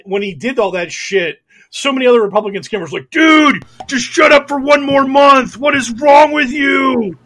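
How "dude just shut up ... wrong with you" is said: high, loud pleading shout; exasperated scammer. Acted. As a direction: yell at a pal who's blowing the con. Voicing exasperated fellow scammers.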